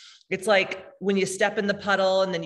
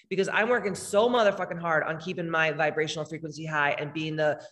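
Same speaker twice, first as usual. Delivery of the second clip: ordinary talk, nothing marked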